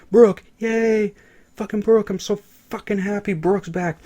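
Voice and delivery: real fan delight bursting out; excited cheer. Real, not acted. Thrilled a character returns.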